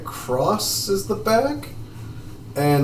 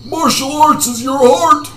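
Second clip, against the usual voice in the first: high, loud character rebuke; scolding master; 13 semitones higher, 5 dB louder than usual. Acted. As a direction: berate a fallen pupil in a high voice. Voices the master lecturing Garrick.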